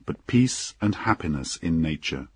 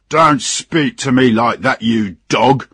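loud, higher-pitched captain's rebuke; angry shout; 5 semitones higher, 7 dB louder than usual. Acted. Captain cutting off the drunken engineer, shouting angrily. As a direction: shout down an insolent subordinate. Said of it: "shouted the captain".

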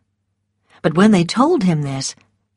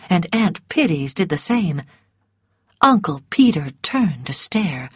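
plain reading voice

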